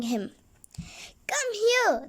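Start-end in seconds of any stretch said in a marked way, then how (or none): 1.3-2.1 calling. A narrator's reading. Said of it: "they called".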